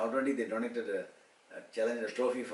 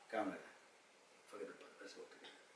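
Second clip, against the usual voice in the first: retold peace offer; 2 semitones lower, 17 dB quieter than usual. Speaker offering Raja reconciliation, softly coaxing. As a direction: coax quietly, letting the words fade.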